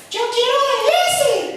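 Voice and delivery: voz aguda de niño; niño caprichoso. Acted. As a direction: pide como un niño pequeño. Niño pidiendo en la tienda. (niño que pide con voz aguda)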